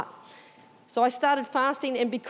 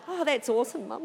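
playing it casual; offhand, contained approval; cool, calm, collected; 3 dB quieter than usual. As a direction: feign understated calm, hiding excitement. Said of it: "cool, calm and collected".

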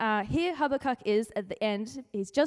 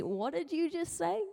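mock disbelief; 5 semitones higher, 4 dB quieter than usual. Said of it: "Habakkuk's pretty much just like".

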